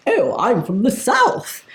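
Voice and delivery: put-on slow, drawn-out posh English accent, teasing; mock posh English accent. Partner mocking his answer to the comedian, slow mock posh English accent. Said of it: "the furthest away from a Welsh accent".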